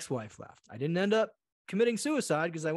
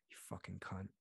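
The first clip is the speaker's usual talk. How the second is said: low, much softer hissed curse; muttered insult; 8 semitones lower, 17 dB quieter than usual. Retold or imitated. Guy mutters insult after holding door, low and soft. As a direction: spit it under your breath.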